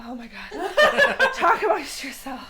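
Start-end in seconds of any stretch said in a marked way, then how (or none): none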